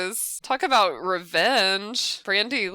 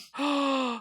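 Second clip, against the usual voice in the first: sharp, high intake of breath; startled gasp; 6 semitones higher than usual. Real, not acted. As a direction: gasp at a sudden sight. Watching the fire spread.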